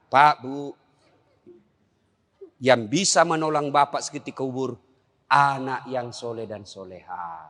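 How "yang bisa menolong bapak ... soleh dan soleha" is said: ordinary talk, nothing marked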